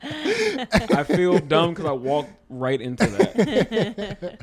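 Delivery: laughing through the words, slow; real laughter while admitting defeat. Real, not acted. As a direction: chuckle it out, embarrassed at the pun. Caught by a pun, laughing.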